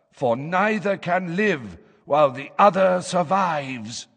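high, harsh, hoarse fortune teller; slow, loud trance-like seer voice; trance prophecy voice. A narrator's reading. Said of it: "in the harsh, hoarse tones".

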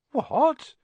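high, rising, startled question; astonished boy. A narrator's reading. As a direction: ask in high-pitched amazement. Astonished boy hears he is famous.

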